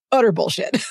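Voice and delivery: laughing it off; amused laughing denial. Real, not acted. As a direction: say it through a laugh.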